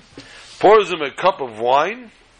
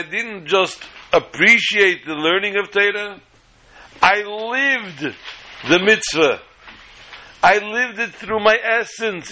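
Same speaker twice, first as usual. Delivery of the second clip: high, slow, emphatic testimony; fervent gratitude; 4 semitones higher than usual. Acted. Guest thanking the Rebbe after eating in the sukkah, fervently moved. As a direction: testify earnestly, stretching the key words.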